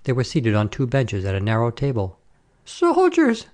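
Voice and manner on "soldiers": very high shrieked alarm; screeching woman raising the alarm; screamed. A narrator's reading. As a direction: shriek it in outrage. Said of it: "screamed a woman".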